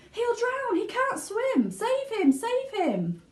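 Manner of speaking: very high panicked shriek; screaming mother. A narrator's reading. Mother screaming as her son sinks, very high. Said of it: "screamed Missus Gloop".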